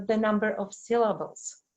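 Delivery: ordinary delivery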